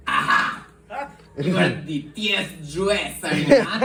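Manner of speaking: Russian suitor greeting with a flourish; Russian accent. Acted. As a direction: put on a hammed-up Russian accent. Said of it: "that's what Russian people sound like".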